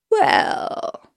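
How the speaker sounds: drawn-out wolf's "well"; a long, musing wolfish word. A narrator's reading. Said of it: "said the wolf".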